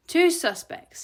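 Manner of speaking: high, loud, impatient insistence; emphatic correction. A narrator's reading. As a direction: repeat it firmly as if to a dim assistant. Boss firmly repeats to assistant.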